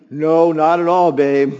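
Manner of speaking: loud, breezy fake innocence; feigned innocence. Husband fibbing to his wife, loud innocent denial. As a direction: deny it with a straight face.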